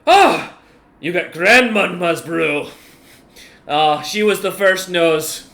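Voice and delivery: slow, loud exclamation in character; delighted big commander. Acted. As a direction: savour it with a loud opening cry. Enlarged commander hails grandmama's brew.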